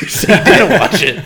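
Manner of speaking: loud, high, through real laughter; real laughing accusation. Real, not acted. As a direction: crack up while calling them out. Cracking up at co-host's admission.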